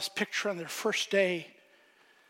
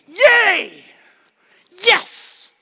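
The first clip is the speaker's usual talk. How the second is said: cheering, much higher and louder; excited cheering; 12 semitones higher, 15 dB louder than usual. Retold or imitated. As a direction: whoop like parents in the stands. Parents cheering their son's strikeout.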